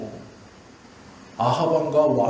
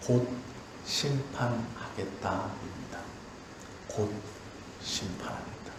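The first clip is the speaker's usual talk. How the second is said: much lower, softer, almost breathed; hushed verdict; 6 semitones lower, 10 dB quieter than usual. Acted. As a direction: drop to a grave hush for the judgment. God's verdict whispered dramatically.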